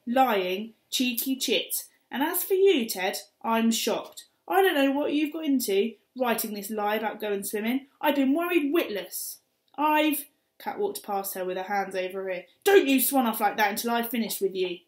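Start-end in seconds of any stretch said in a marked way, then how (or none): 0.1-10.3 loud, high scolding; angry mum screech. Acted. 12.6-14.8 scolding mother. Acted. Mum scolding Kat leaving.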